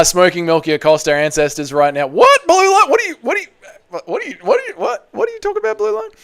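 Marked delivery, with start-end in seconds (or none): 2.1-6.1 high, rapid baffled repeats. Real, not acted. Streamer baffled by a chat message, much higher. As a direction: squeak out total confusion.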